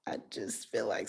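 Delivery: hushed fast whisper. Real, not acted. Telling viewer they're watched, whispered. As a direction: lean in and whisper it quickly. Reading suspicious energy.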